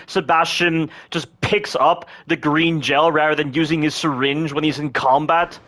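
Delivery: normal talking voice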